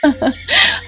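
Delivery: short breathy chuckle; daughter-in-law chuckling at her mother-in-law's jibe; soft laugh. Acted. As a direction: laugh it off under your breath. Mala laughs at mother-in-law's retort.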